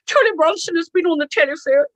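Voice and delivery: a hint of a breathless posh secretary reporting. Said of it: "a secretary called Alice once, who was quite posh".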